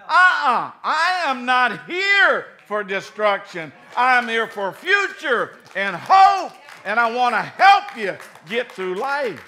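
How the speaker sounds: shouting God's answer to Satan; full-force preaching. Acted. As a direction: thunder the promise out.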